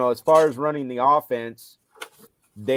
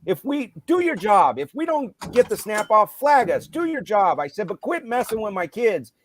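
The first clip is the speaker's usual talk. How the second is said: angry yelling; 8 semitones higher than usual. Retold or imitated. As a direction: let the anger climb to a yell. Continues yelling at ref.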